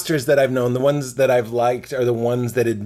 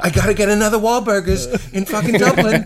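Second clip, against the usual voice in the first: mock celebrity; 6 semitones higher than usual. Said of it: "they're just like".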